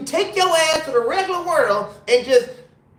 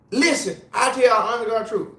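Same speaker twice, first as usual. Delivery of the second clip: normal talking voice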